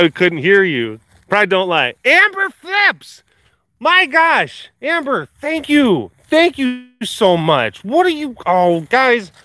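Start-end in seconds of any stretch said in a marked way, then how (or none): 2.0-9.3 high, slow, drawn-out thank-you; gushing thanks. Real, not acted. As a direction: gush over the donation, way up high.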